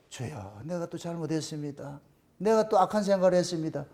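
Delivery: hushed, low confession; contrite prayer. Retold or imitated. Preacher confessing his fault to the Lord, hushed repentance. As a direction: murmur it humbly, soft and low. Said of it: "그렇게 고발을 당할 때".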